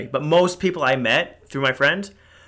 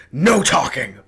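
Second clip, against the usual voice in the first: mock loud order from a waiter; put-on telling-off voice; imitated stern scolding; 4 dB louder, slower than usual. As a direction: imitate an officious waiter cutting in loudly. Retold waiter scolding the speaker.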